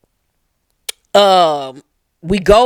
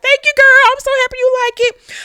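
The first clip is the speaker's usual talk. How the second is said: gushing high voice; faster than usual. Retold or imitated. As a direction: gush like a flattered diva. Voicing Ian flattered.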